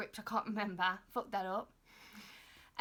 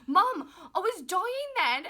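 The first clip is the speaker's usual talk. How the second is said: loud, high and slow, still shaken; exaggerated slow wail of relief; breathless exclaim; 9 semitones higher, 10 dB louder, slower than usual. Telling mum after the choking, loud drawn-out wail.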